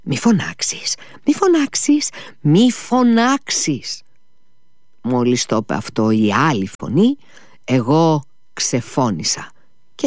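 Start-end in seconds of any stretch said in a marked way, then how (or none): 0.0-4.0 rising from hushed to high, drawn-out warning; opposing inner voice. Retold or imitated. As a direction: imitate a second inner voice, pleading ever higher. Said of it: "μια άλλη μου έλεγε".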